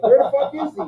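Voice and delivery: high, loud, baffled acting-out; exasperated retold question. Storyteller asking friends where the caller is, exasperated. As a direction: punch it up high, unable to find him.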